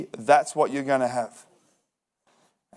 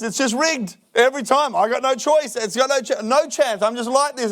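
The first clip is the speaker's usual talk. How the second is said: higher, mock self-pitying grumble; mock whiny excuse; 7 semitones higher than usual. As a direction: imitate a man blaming the system, pitched up. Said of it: "And that's what he says".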